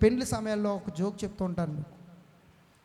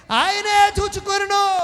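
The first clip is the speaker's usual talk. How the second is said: high, loud, slow shout; proclaim; 10 semitones higher, 9 dB louder, slower than usual. Acted. Pastor answers his own question with a shouted proclamation. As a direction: shout it out slowly to the congregation.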